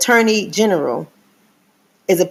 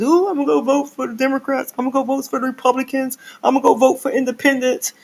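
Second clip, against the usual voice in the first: high, giddy mock voter voice; high-pitched mock enthusiasm; excited voter; 7 semitones higher, 3 dB quieter, faster than usual. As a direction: mimic eager voters gushing about voting.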